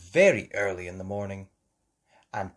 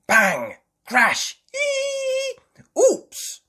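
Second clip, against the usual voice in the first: high, loud voiced sound effects; noisy crashes; 8 dB louder, much slower than usual. A narrator's reading. Mummy Pig hears lots of noise, noisy crashes. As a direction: act out each bump and squeal sharply. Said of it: "She could hear lots of noise".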